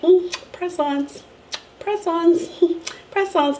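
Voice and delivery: smug sing-song. Retold or imitated. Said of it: "And I'm just like".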